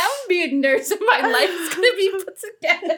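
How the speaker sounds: high, laughing declaration; giggly high-voiced send-up of big hopes; mocking past self. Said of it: "that's how I was saying".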